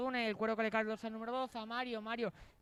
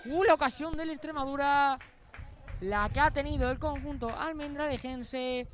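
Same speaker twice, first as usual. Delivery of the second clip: excited near-miss call; 4 semitones higher, 7 dB louder than usual. Real, not acted. Near chance for Extremadura.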